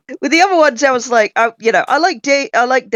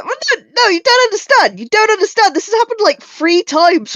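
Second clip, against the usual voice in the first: very high, excited protest; heated protest; 11 semitones higher than usual. Real, not acted. Player protesting heatedly to the game master. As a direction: insist they just don't get it.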